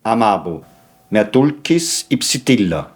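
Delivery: slow, measured verse reading; Latin poem recitation. Acted. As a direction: recite Latin verse with drawn-out line endings.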